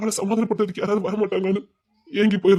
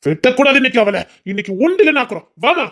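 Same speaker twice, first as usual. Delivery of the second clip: mock fierce rallying; 5 semitones higher, 5 dB louder than usual. Father hypes daughter for fight.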